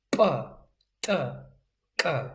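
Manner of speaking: p, t, k, each popped out on its own; forceful isolated consonants. Shown as a demonstration. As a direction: hit "p, t, k" with extra effort, pausing between. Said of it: "extra effort to make these sounds impact".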